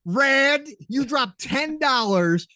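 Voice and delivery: loud, high, drawn-out fake indignation; mock outrage. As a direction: scold a friend in playful envy. Mock outrage at gifted player.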